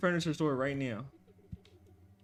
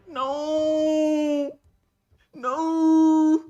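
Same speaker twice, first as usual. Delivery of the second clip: rising panic; 7 semitones higher, 6 dB louder than usual. Real, not acted. Dread building as it nears.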